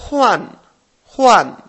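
"huan" loud, high and falling; teacher projects "huan", each one dropping in pitch; soft H, fourth tone. Shown as a demonstration. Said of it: "es una H más suave".

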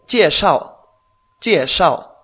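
jieshao with both syllables falling, slow; fourth tones. Shown as a demonstration. Teacher models jieshao's falling tones for the student. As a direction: drop the pitch on jie and on shao. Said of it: "cuarto tono".

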